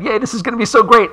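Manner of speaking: fast, high cheering; excited kids. Retold or imitated. Kids cheer over hidden dragon eggs, fast and high. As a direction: gush like a giddy kid. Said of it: "the kids are all excited".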